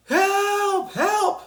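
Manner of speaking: high, far-off cry of help; distant call for help. A narrator's reading. Trapped girl calling from the distance for help. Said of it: "heard a voice call in the distance".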